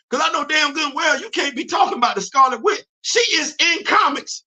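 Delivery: high, shouted disbelief at the fans; exasperated rant. Real, not acted. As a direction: yell it like you can't believe them. Ranting at MCU fans.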